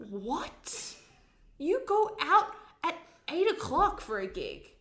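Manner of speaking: stunned, high, loud incredulous friend; mock disbelief. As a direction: act out scandalised disbelief at a friend. Acts out friends' disbelief at gig.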